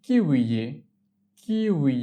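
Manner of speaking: slow, lengthened "kiwi" for learners; drawn-out model. Shown as a demonstration. Teacher models the K word for learners, drawn out. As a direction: stretch out each syllable of "kiwi".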